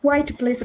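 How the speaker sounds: "কোয়াইট" as one syllable, kwite; mispronounced quiet. Shown as a demonstration. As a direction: say "কোয়াইট" like quite, without the second syllable. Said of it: "এটার উচ্চারণ না".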